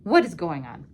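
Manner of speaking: plain reading voice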